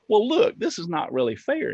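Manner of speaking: city slicker lightly voiced, pleading his case; mild protest. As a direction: open high and push back, only half in earnest.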